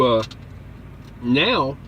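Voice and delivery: usual speaking voice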